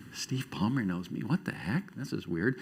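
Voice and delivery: soft, low retold inner thought; hushed, understated disbelief; bewildered aside. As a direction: drop the volume as if puzzling privately. Retold bewildered inner reaction to greeting.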